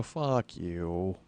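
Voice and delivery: low, soft, stunned curse; joking disbelief. Retold or imitated. Customer told the loaner has everything requested, hushed joking disbelief. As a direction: drop the voice and stretch it out in mock disbelief. Joking reply to the good news.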